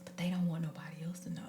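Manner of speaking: quick confiding whisper; hushed, fast, like sharing a secret. Real, not acted. Confiding a secret about others.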